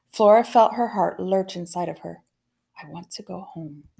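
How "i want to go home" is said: much softer, fading repeat; softer sad plea. A narrator's reading. William Spiver repeating his wish to go home, much softer. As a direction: say it again barely aloud. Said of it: "sad, beautiful sentences".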